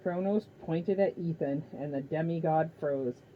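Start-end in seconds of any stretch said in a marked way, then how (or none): none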